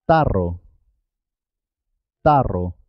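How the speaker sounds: tarro with a trilled rr; marked double R. Shown as a demonstration. Teacher has learners repeat tarro, double R trilled. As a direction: roll the R in tarro. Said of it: "Es importante marcar".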